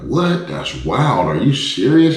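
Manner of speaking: high, loud disbelief; retold amazement at the kidnap tale; amazed. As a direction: react with wide-eyed shock. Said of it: "And I was like".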